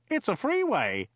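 high-pitched protest; high, indignant objection. A narrator's reading. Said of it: "protested Joe".

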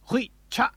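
"Hui cha" high-pitched, both syllables cut off abruptly; strong Klingon curse. Shown as a demonstration. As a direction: spit out "Hui cha" with a humming rasp. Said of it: "It's a lot stronger than the Terran".

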